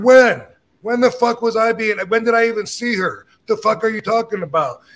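retold indignant protest, pitched high; indignant disbelief. Accused man answering the angry husband, retold indignant disbelief. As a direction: fire back incredulously, voice jumping up. Acted quarrel over an accusation.

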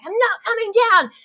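high, drawn-out mock yell; retold defiant holler; shouted refusal. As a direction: yell it back down to them, imitating yourself. Shouting back down from rock.

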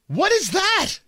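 squeaky cracking voice jumping very high. Real, not acted. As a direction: voice breaks up into a squeak. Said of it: "for the voice crack".

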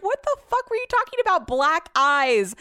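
high, loud retold disbelief; incredulous outburst. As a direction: shriek it in mock outrage, stretching the last words.

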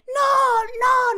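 high, loud "No, no"; retold yell. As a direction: yell no in a panic. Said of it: "nada más grita".

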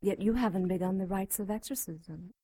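ordinary talk

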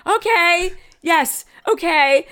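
high, loud, hammed-up eagerness; mock eager yes. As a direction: oversell the yes like an actress. Eager actress accepting the favour.

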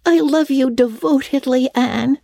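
put-on high, earnest girl's declaration; staunch. A narrator's reading. Diana declares devotion to Anne, staunchly. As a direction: declare it firmly in a high child's voice. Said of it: "said Diana staunchly".

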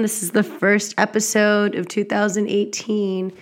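normal talking voice

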